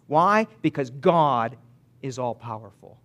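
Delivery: ordinary talk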